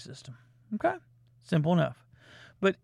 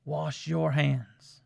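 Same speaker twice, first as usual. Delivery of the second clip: slow solemn; 4 semitones lower, much slower than usual. Acted. Solemn sign-off.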